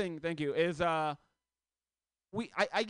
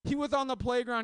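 ordinary talk

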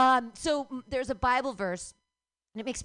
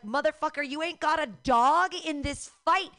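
put-on loud, higher angry snap at the kid; angry retort; 6 semitones higher, 7 dB louder than usual. Comic retelling what she said to the boy, in a loud angry snap. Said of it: "And I was like".

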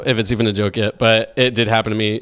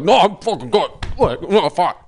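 mock tough-guy denial, pitched higher; stubborn dying man; 5 semitones higher than usual. Man refusing help mid heart attack, mock gruff denial. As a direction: play a man brushing off his heart attack, a bit higher. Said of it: "like, fucking having a heart attack".

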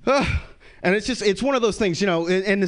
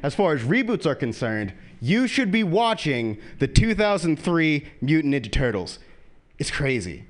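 ordinary talk

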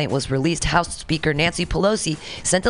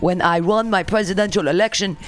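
hammed-up foreign president in a fake Russian accent; bad Russian accent. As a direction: read formally in a badly faked Russian accent. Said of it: "how do I sound like a Russian".

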